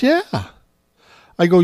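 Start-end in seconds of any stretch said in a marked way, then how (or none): 0.0-0.5 put-on little girl voice. Toddler granddaughter voiced.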